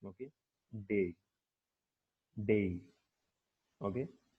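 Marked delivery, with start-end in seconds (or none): 0.8-2.8 डे said on its own as the prominent syllable; just the syllable डे, isolated; stressed syllable alone. Shown as a demonstration. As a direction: isolate डे and let it stand alone. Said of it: "सबसे prominent ... वो है डे".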